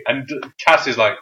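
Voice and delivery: ordinary talk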